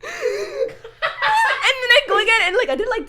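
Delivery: slow, high, laughing drawl. Real, not acted. Retelling crying again in class, laughing. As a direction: stretch it out through laughter.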